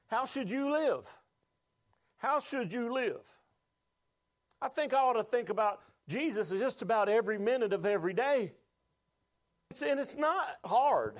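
high, emphatic exhortation; impassioned preaching. Real, not acted. Preacher urging the congregation on how to live, high and impassioned. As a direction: press the point on the congregation.

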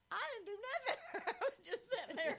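very high, loud squeal of innocence; mock protest. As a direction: whine it high and loud, playing wrongly scolded. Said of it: "And I'm like".

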